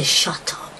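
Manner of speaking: hushed, whispered warning; whispered shut up. Retold or imitated. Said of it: "that's when I say".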